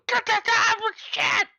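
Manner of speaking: high, loud shout to hang up; angry yell from the phone. Retold or imitated. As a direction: yell at them to get off the line. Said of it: "whoever's on the phone would go".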